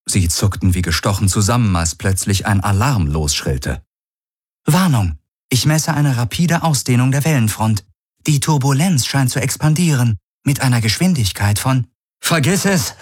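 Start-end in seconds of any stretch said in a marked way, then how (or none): 4.6-11.9 lightly voiced higher-pitched ship's computer; a hint of automated alert voice; ship's computer warning. A narrator's reading. Ship's computer reports an alarm. 12.2-13.0 high, cutting-off exclamation; urgent cry without much added volume; urgent call-out. A narrator's reading. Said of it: "rief Galen".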